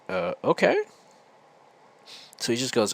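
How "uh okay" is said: higher, rising, doubtful okay; unsure agreement. Retold or imitated. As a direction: agree as if asking a question. Retold unsure agreement to instructions.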